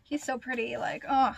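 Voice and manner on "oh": usual speaking voice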